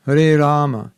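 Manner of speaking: Hare Rama with a long first A and a short last A; proper Sanskrit Hare Rama. Shown as a demonstration. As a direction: correct Sanskrit pronunciation. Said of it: "the proper Sanskrit is Rama".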